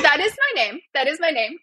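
high-pitched insistence on "that is my name"; insisting, not asking. Shown as a demonstration. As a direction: correct the questioning slate, pressing each word of "that is my name". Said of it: "not a question".

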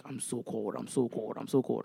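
low, soft whiny "I'm so cold" mimicked; complaining dad. Imitating dad shivering on the party boat, low and grumbly. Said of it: "my dad was just like".

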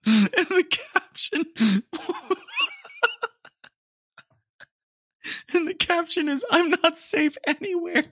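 high voice with laughs bursting through; fighting the laughter to read the caption; laughing. Real, not acted. Reading the TikTok caption to viewers through laughter. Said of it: "I'm dying".

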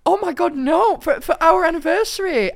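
hammed-up flustered denial; panicked correction. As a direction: rush to fix the mix-up. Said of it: "And I go".

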